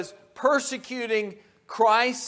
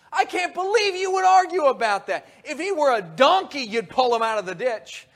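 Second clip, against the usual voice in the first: loud, much higher, indignant; angry rebuke; 6 semitones higher, 6 dB louder than usual. Acted. Jesus rebukes the arguing crowd, loud and indignant. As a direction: scold them for arguing over healing.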